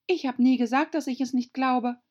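put-on high, loud girlish protest; protesting young Alice. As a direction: object quickly in a high voice. (hohe Alice-Stimme, protestierend)

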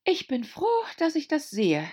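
put-on high young girl voice; little girl Alice. A narrator's reading. Alice thinks.

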